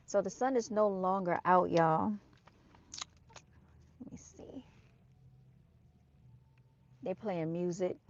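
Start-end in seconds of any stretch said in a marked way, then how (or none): 4.0-4.6 under her breath. Real, not acted. Talking to herself while filming.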